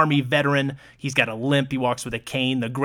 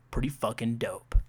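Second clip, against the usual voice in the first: normal talking voice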